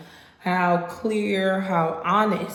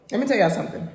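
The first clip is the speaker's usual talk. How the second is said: ordinary talk, nothing marked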